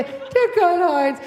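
slow, drawn-out call to a mate; a man at the urinal calling his pal over; mock urinal buddy. Man calls another buddy.

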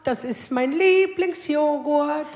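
slow, drawn-out happy son voice; imitating pleased son. Son delighted at the fridge, slow mock joy. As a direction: savour the word like a treat. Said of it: "freut er sich auch und sagt so".

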